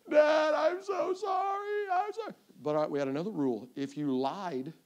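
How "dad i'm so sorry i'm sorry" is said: high, drawn-out tearful whine; mock child crying. Daughter begging dad before her spanking, mock crying. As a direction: plead like a sobbing little girl.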